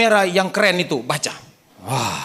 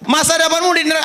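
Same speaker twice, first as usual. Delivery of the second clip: mock confrontation; 11 semitones higher, 4 dB louder, faster than usual. Preacher acts out confronting a possessed man.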